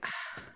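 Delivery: a quiet exhaled sigh; soft sigh. Shown as a demonstration. Showing viewers how she sighs mid-video, soft sigh. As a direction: let a little sigh slip out. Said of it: "I'll be like".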